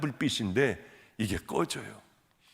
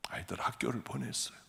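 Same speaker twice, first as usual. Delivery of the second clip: whisper; 4 semitones lower, 11 dB quieter than usual. Real, not acted. Repeat whispered.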